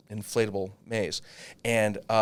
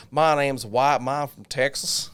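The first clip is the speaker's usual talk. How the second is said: light Texas drawl, drawn out; a hint of a Texas kid's drawl; southern drawl; 3 semitones higher, 4 dB louder, slower than usual. A light Texas drawl for the kid from Texas. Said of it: "He had the southern drawl".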